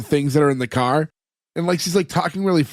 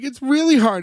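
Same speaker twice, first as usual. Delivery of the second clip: lightly imitated upset mom, higher pitch; mock the overwhelmed admission, pitched up; retold tearful admission; 8 semitones higher than usual. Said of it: "And she's, like, crying".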